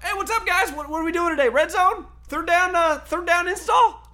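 breezy nothing-happened act. Retold or imitated. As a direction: play it like nothing ever happened, high and chirpy. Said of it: "just acting like nothing happened".

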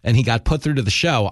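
ordinary delivery

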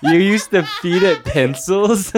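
loud, high, slow laughing question; incredulous question through laughter; laughing disbelief. Real, not acted. Laughing disbelief at own hamster story.

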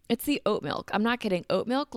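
usual speaking voice

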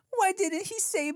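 put-on high-pitched, upset little kid; Veda. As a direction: imitate a small child's high whine. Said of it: "Veda's like".

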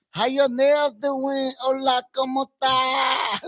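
high, drawn-out mock Vietnamese accent carried into Spanish; mock Vietnamese Puerto Rican accent. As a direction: play a Vietnamese nail-salon worker switching to Spanish. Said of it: "like a Vietnamese Puerto Rican".

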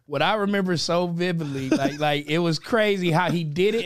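normal talking voice

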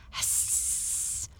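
breathy whispered hiss; cat hiss. Acted. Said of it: "and how she hissed".

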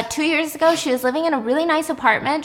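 normal talking voice

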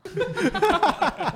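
big burst of laughter; loud laugh. Real, not acted. Reacts to crude question.

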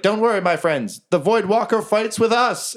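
high-pitched goblin leader; rally your friends in a high voice. Acted. Game master voices Jerry rallying goblins.

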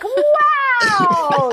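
high, excited crowd gasp of wow; amazed crowd. Retold or imitated. Stunned crowd cheering the trick shot, high and excited. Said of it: "everyone was like".

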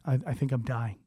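a hushed frightened confession; scared murmur. Retold or imitated. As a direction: say it small and afraid. Panicking quietly on stage.

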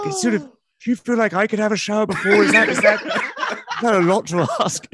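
polite, tentative asking, slowing to the end through a laugh; gently probing voice, half laughing; Louis Theroux impression. Asking his wife about a shower, in mock Louis voice. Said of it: "And I'd be like".

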